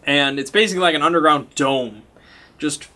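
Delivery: normal talking voice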